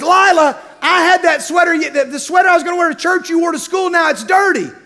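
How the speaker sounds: mock older sister angry about a sweater; annoyed sister. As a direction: imitate the daughter scolding her sister. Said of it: "she says".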